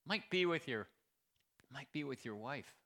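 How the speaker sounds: ordinary talk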